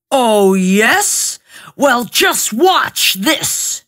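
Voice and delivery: slow, shouted ogre challenge; ogre retort. A narrator's reading. Ogre shows off to the cat, slow and shouting. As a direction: roar it out slowly to show off. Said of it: "retorted the ogre".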